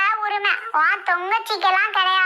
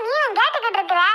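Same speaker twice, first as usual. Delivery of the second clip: normal talking voice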